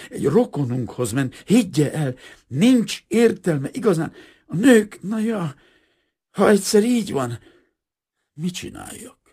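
high, loud, exasperated pleading; reproachful. A narrator's reading. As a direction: protest helplessly, voice climbing high. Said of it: "inkább szemrehányon, mint haragosan".

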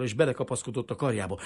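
ordinary delivery, nothing marked

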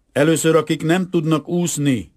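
high, loud call to the group; shouted order. A narrator's reading. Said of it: "kiáltotta".

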